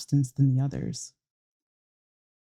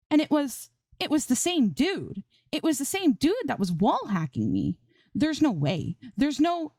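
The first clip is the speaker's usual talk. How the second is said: much higher, exasperated rant; frustrated outburst; 11 semitones higher than usual. A narrator's reading. Streamer realizing the hacker killed her again, frustrated. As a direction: spill it out in disbelief. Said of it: "in frustration".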